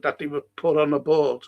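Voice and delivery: normal talking voice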